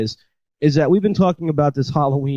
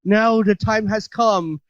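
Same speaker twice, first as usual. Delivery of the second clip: slow, higher-pitched ominous imitation; spider woman; 8 semitones higher, slower than usual. Spider woman starting her transformation, slow high portentous imitation. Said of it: "she's just like".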